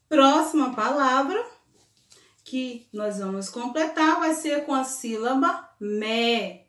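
normal talking voice

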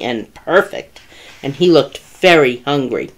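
usual speaking voice

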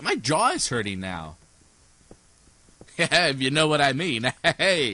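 ordinary delivery

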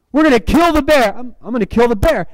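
rallying cry, high and forceful; imitated shouting. Preacher acting two film characters psyching each other up, shouting. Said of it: "there's, like, shouting it back and forth".

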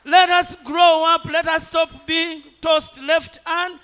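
slow, very loud, high exhortation; rally the congregation at the climax, slowly and at full volume; full-force preaching. Acted.

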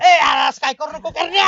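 loud, urgent plea to stop; urgent protest. Acted. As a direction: call out to stop him. Begging friend to stop shouting.

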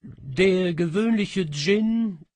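slow, high, loud words before a pause; the cat savours its title, high and slow; dramatic pause. Acted. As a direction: draw out Djinn, then hold. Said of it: "machte eine Kunstpause".

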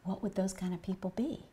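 very soft, hushed question with a rise; retold whisper. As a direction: whisper back as if keeping his secret. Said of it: "And he's whispering".

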